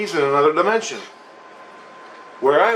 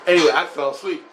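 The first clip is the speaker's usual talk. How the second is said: ordinary delivery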